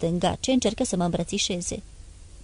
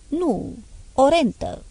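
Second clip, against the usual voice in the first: mild choked reply, a bit higher; 6 semitones higher, slower than usual. A narrator's reading. Son confessing the bribe with a mild choke. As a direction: squeeze it out, lightly choked. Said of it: "răspunse cu un glas sugrumat".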